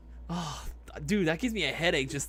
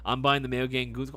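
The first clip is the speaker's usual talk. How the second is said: ordinary talk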